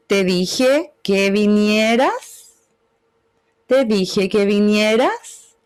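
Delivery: slow sung-like curve that climbs back up at "vinieras"; melody falls then rises; curving tune. Shown as a demonstration. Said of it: "Tengo una curva que parte arriba, me voy hacia los graves".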